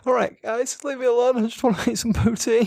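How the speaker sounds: high put-on plaintive voice; mock pleading. Teasing the booed contestant by voicing his plea, mock pleading. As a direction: plead in a high, hammed-up whine.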